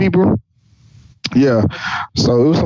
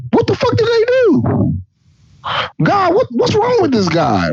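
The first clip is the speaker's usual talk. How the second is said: incredulous shriek; 14 semitones higher than usual. Real, not acted. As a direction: voice cracks way up in disbelief. Outraged disbelief at God's plan.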